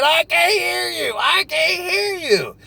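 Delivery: high, strained shout of a caller who can't hear. Retold or imitated. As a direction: yell it into a bad line. Customer on a bad phone line.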